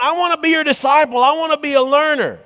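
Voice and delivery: high, fast eager plea; eager young man. Acted. Disciple, third time.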